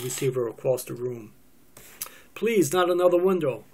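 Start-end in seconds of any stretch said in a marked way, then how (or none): 2.3-3.6 high, loud plea to stop; raised, louder pleading; alarmed plea. Acted. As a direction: cry out to head off damage. Acted plea as phone is thrown.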